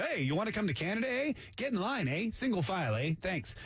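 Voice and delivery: lightly mocked friendly Canadian, quick and lilting; breezy Canadian invitation, lifting each "eh"; Canadian recruiter. Canada's official inviting refugees into line, mock friendly Canadian. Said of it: "Canada set up a table and said".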